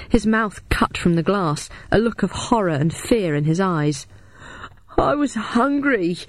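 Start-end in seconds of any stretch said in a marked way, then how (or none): 5.0-6.2 high slow wail; howled. A narrator's reading. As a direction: howl it out miserably. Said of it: "he howled".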